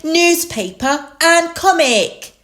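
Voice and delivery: high, loud little piglet; Peppa. A narrator's reading. Peppa delivers the dogs' shopping, high-voiced. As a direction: announce each item in a child's pitch. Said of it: "Peppa said".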